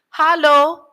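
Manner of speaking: high, sung-out Hello with an open a, halo; Hello as hah-lo, high and lilting; local hello. Retold or imitated. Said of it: "they'll say".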